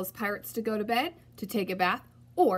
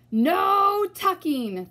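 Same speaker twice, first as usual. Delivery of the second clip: high, loud, slow cry; crew cry; 9 semitones higher, 7 dB louder, much slower than usual. A narrator's reading. Pirate crew crying out against tucking, loud and slow. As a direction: stretch it out in outrage. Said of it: "the crew cried".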